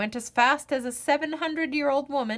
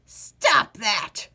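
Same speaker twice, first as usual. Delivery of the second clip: put-on loud, cracked old man's protest; old man scolding the snooping ghoul; old man crying out; 4 dB louder than usual. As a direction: snap at the ghoul like an old grouch. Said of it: "cried the old man".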